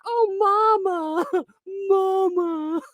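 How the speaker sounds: very high, hammed-up cooing; high mock-babyish call; mock adoring fan. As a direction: imitate a gushing fan up high. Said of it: "being like".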